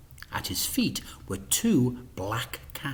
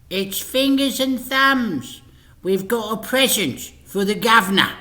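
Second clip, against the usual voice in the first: high crook voice; 11 semitones higher, 7 dB louder than usual. A narrator's reading. Fingers to the intercom, cocky. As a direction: announce yourself like a shifty crook.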